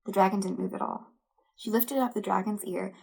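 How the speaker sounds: usual speaking voice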